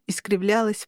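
usual speaking voice

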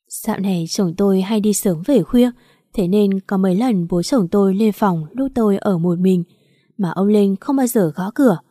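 plain reading voice

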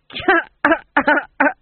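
loud, high tipsy cackle; drunken laugh. A narrator's reading. Fool laughing at his own remark, drunken cackle. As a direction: laugh helplessly, pitched high.

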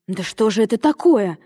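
plain reading voice

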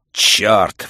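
loud outburst; exclaimed curse. Acted. Montag interrupts the chattering women, exclaiming. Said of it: "воскликнул Монтек".

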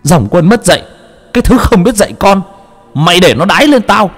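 high, strange, spitting curses; possessed son cursing his father. A narrator's reading. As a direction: rage through the abuse. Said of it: "mà chửi xối chửi xả".

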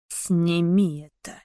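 slow, low, grave menace; dark lord voice; sepulchral voice. Acted. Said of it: "сказал он за могильным голосом".

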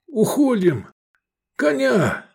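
high, strained order; urgent command. A narrator's reading. Wounded man calls for a horse, urgent cry. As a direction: urge them away in a high voice.

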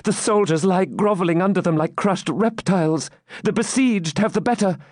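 high-pitched, agitated report; dismayed maiden. Acted. Maiden reports besieged winning, distressed. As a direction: relay the setback with acted dismay.

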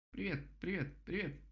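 высокая скороговорка дятла; повторяй без остановки, высоким птичьим голосом; тараторящий дятел. A narrator's reading. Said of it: "затараторил дятел". (тараторя, голосом дятла)

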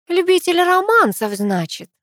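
teasing, starting high and dropping; wry amusement. A narrator's reading. Sister teases Миша about romances, wry amusement. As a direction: tease him with a knowing smirk. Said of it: "усмехнувшись, покачала головой Ангелина".

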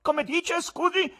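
high, loud questioning voice. A narrator's reading. Showman to the gallery after a brutal suggestion, high-pitched query. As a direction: pipe up instantly at the ugly proposal.

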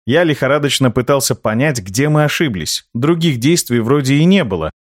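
usual speaking voice

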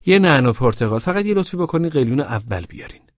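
Laleh ordering. A narrator's reading. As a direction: place the order and ask for the hookah first. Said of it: "لاله گفت".

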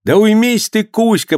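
high, urgent shout; shouting over everyone. A narrator's reading. Pivovarov tries to outshout the whole crowd, yelling. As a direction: yell above the brawl to calm him. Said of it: "пытался перекричать всех Пивоваров".

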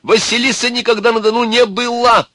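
very high, loud pronouncement; irritable sage. A narrator's reading. Sage rushing through reception, indignant. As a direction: declare it indignantly in a high old voice.

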